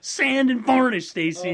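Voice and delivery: slow, high, very loud holler; exasperated shouting. Real, not acted. Co-host hollering advice to the caller, drawn-out shout. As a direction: bellow it out word by word.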